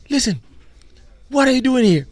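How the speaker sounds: very high, loud questioning; imitated excited promoter. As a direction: buttonhole him with loud excitement.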